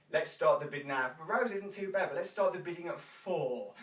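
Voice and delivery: ordinary talk, nothing marked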